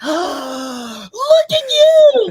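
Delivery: big gasp, then a loud, high, drawn-out squeal; hammed-up delighted shriek, words stretched out; extremely enthusiastic. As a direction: imitate a thrilled mom gushing over her kids. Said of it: "she comes out extremely enthusiastic".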